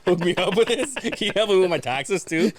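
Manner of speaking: hamming up a client begging for help; mock pleading client.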